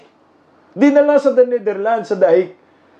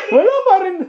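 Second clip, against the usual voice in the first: normal talking voice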